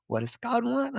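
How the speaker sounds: a real welling-up, the question breaking upward; getting emotional. Real, not acted. Guest overcome while explaining gratitude, voice cracking high. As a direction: voice rising high and catching with emotion. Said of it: "I don't know why I'm getting emotional".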